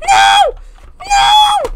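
loud, very high cry of dismay; real panicked yell. Real, not acted. Player who threw a burger away, panicked yell. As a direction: yell it as if it just went wrong.

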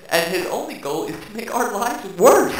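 ordinary talk, nothing marked

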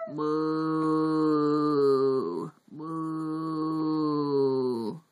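deep, drawn-out cow moo; low mooing cow. Acted. Parent reading to baby, mooing like a cow.

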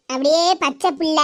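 ordinary delivery

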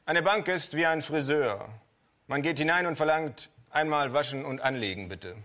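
a hint of a pompous lecturer; quoting the old professor. Narrator quoting his old business professor, lightly mocking. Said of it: "sagte immer".

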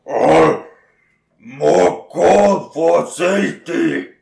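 slow, loud ogre demand. Acted. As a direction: rumble it like a greedy ogre. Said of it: "the ogre says".

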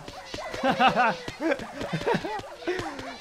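high, long laughing fit; hearty laugh. Real, not acted. Funny rain of animals.

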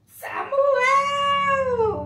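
loud, high, very drawn-out name; grand sustained call. Acted. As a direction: hold the name long like a summons. Re-enacts God's call to Samuel.

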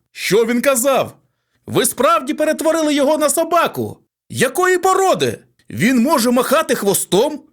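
loud, high, fast pushy newsman; clamouring reporter. A narrator's reading. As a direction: shout over the crowd for a scoop.

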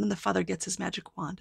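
ordinary delivery, nothing marked

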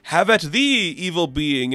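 high, lofty Loki voice; hammy godly boast, pitched high. Acted. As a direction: Loki delighting in the hammer's power. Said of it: "and they're like".

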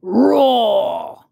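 loud playful dinosaur roar; a child's big pretend roar. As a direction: roar like a toy dinosaur waking everyone. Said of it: "cries George".

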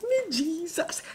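high catchphrase. Acted. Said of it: "I'm back on my bullshit".

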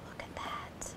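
hushed whisper. Real, not acted. Counselor pointing at a picture for campers, whispering. Whispered aside during story.

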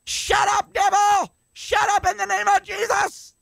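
high, full-throated yell at the devil; rebuking shout. Shown as a demonstration. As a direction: bark it down with total authority. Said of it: "That's the way you're gonna have to talk".

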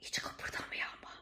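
tense hushed whisper. Real, not acted. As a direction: whisper so nobody hears. (fısıldayarak)

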